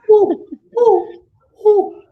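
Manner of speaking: high, loud owl hoot. Retold or imitated. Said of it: "And the owl said".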